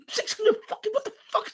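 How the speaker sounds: cracking up in shock at the bill; laughing disbelief. Retold or imitated. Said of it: "I'm like".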